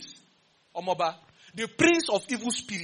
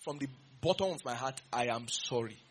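soft, lower, sincere sorry; sincere apology; 7 semitones lower, 6 dB quieter than usual. Shown as a demonstration. Preacher models a sincere apology to the congregation, soft and low. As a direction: model a heartfelt apology, softly. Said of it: "Come out and say".

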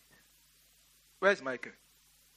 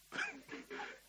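high breathy giggle; 14 dB quieter than usual. Real, not acted. Laughing at his own story.

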